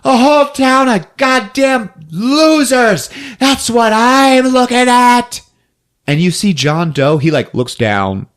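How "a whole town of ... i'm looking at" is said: high, loud mock tirade; hammed-up hysterical yelling; yelling at a crowd. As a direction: scream abuse at a whole crowd, drawing it out. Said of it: "she just yells to the crowd".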